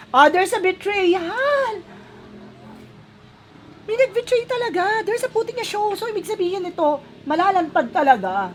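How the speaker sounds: high-pitched shocked excitement; shocked and excited. Real, not acted. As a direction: react like the card just betrayed you. Shocked at betrayal card.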